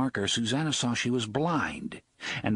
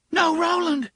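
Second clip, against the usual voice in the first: high, loud cry of horror; horrified cry; 7 dB louder than usual. A narrator's reading. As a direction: cry out in alarm, pitched way up. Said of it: "sound of her own horrified voice".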